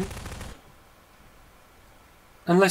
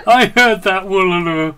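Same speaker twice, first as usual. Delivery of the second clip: loud calling out; yelling across the room; 4 semitones higher, 10 dB louder than usual. Real, not acted. Calling out loudly.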